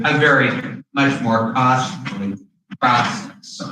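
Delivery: usual speaking voice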